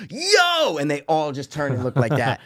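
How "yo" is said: high loud yell of yo. Retold or imitated. Retelling yelling at protesters, loud yell. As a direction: call out sharply to the crowd. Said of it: "I was like".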